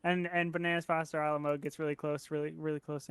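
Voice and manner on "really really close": ordinary delivery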